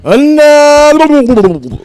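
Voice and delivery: squeaked high "uh, no" in disbelief; a high incredulous squeak; high mock disbelief. Real, not acted. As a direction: pitch it way up, incredulous. Reacting to story, squeaky reply.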